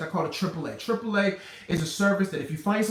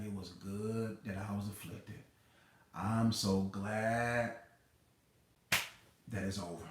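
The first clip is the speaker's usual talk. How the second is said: much softer, very slow, relieved and tender; grateful hushed sigh; 8 semitones lower, 11 dB quieter, slower than usual. Pretend. Imagining lying beside a snoring husband, softly and slowly grateful. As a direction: breathe it out, softly thankful, while he sleeps.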